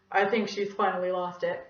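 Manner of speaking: put-on dry kid voice; sarcastic kid voice. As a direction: mutter it like a wiseguy kid. Child commenting on teacher.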